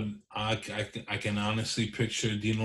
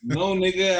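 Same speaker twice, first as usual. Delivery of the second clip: ordinary delivery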